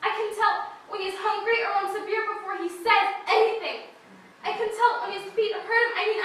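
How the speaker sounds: normal talking voice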